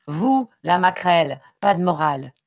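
loud, cutting rebuke; furious yell. A narrator's reading. Son silences his moralising mother, furious yell. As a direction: shout her down with contempt. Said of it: "hurla-t-il".

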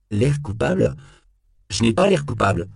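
high, offended echo, then firm denial; stung and defensive; indignant denial. A narrator's reading. Suspect indignantly denies looking guilty.

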